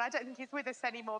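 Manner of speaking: usual speaking voice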